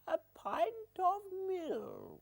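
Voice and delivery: very high, slow mewing cat voice; high slow pussycat reply. Acted. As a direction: drawl it like a little kitten. Grandma's pussycat answers rhyme.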